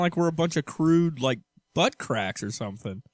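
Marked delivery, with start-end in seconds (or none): none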